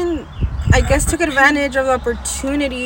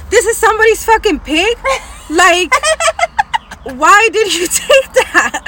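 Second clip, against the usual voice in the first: loud, higher, broken by a laugh; laughing disbelief; 9 semitones higher, 6 dB louder than usual. Retold or imitated. Daughter confronting dad about the neighbor's pig, laughing loudly. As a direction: cry out half laughing, half appalled. Realizing the pig was stolen.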